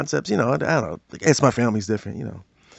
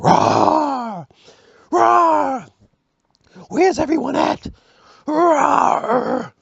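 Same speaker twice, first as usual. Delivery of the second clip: high, hammed-up roaring monster; She-Hulk roar; 12 semitones higher, much slower than usual. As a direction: roar it out, comic brute.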